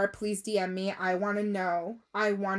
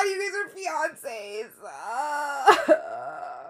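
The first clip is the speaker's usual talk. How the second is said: about to cry; 4 semitones higher, much slower than usual. Real, not acted. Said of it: "I'm gonna cry".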